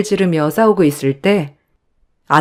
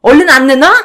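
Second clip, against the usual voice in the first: shouting; 6 semitones higher than usual. Acted. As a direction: scream at the thief. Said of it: "제가 소리를 고래고래 지르자".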